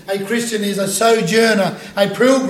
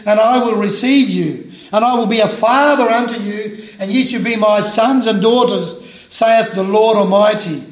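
louder, raised solemn reading voice; scripture reading; 3 semitones higher, 6 dB louder than usual. Acted. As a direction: proclaim the verses to the congregation. Reads scripture aloud.

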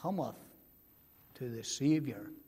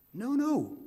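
higher, louder double no; emphatic no; 8 semitones higher, 6 dB louder than usual. Acted. Preacher rejects the doubters' reading, loud and emphatic. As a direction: cut off the doubt firmly.

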